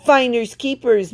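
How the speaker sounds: a cheeky parting shout; teasing call. A narrator's reading. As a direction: fling it over your shoulder, gleeful. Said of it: "May May called over her shoulder".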